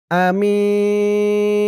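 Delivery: "আমিন" with a very long-held i; three-alif elongation. Shown as a demonstration. Teacher showing the pause rule, আমিন drawn out long. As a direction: stretch আমিন slowly on one note, closing on n. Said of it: "তিন আলিফ টান হবে এখানে".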